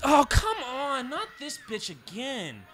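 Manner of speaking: high exasperated yell; frustrated shout. Real, not acted. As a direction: yell it at the screen. Frustrated outburst at game enemy.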